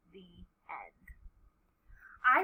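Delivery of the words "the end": very soft, low sign-off; gentle lowered final words; hushed ending. A narrator's reading. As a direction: close the book almost in a whisper. Hushed close of the story.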